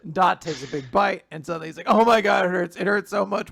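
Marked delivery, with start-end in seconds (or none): 1.9-3.5 mock pain. Voicing Dot in pain.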